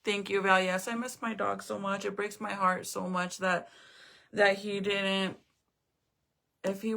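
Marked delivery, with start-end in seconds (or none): none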